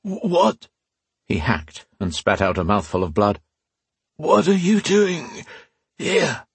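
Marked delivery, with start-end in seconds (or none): 0.0-0.7 startled. Acted. Wounded man startled by ghost. 4.2-6.5 high, slow, strained disbelief; high strained dying man. Acted. Dying man confronts priest's ghost.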